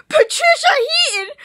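high shrieking fan squeal; freaking out. Real, not acted. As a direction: scream the name in disbelief. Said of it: "I'm, like, freaking out right now".

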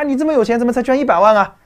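high, loud mocking jeer at a donor; mock indignant demand. Mimicking trolls who scorn a rich donor, in a loud high jeer.

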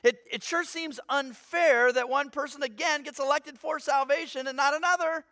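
usual speaking voice

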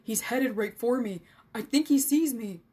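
urgent, loud stage whisper; sharp whisper. A narrator's reading. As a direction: raise the alarm to friends, loud and tense. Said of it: "Lawrence whispered sharply".

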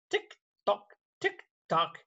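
high ticking tick-tock; clock ticking. Shown as a demonstration. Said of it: "He can sound like a clock".

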